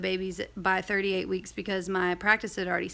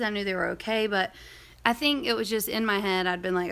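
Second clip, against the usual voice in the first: normal talking voice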